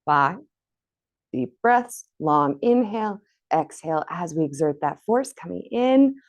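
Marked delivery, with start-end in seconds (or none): none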